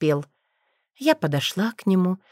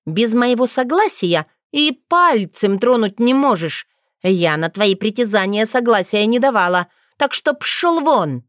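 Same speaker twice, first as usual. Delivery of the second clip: angry rebuke; 4 semitones higher, 4 dB louder than usual. Acted. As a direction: drive him off angrily. Witch rejecting werewolf.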